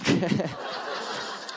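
short breathy chuckle; laugh. Real, not acted. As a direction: chuckle at your own punchline. Laughs after own punchline.

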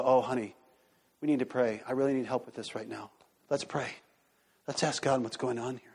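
soft, low plea to a spouse; humble appeal. Acted. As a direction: gently confide a need, voice dropped. Acted humble request to pray together.

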